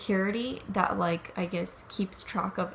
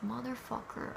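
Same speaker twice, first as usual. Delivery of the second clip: curse under breath; 2 semitones higher, 13 dB quieter than usual. Retold or imitated. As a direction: swear softly under the breath. Muttering after threat on phone.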